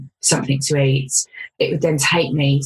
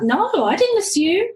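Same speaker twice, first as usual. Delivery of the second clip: indignant retort. Retold or imitated. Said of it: "And I'm like".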